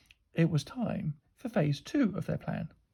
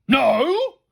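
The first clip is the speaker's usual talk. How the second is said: loud, high exploded refusal; one big thunderous shout; booming; 8 semitones higher, 9 dB louder than usual. A narrator's reading. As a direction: boom it out in fury. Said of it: "he boomed".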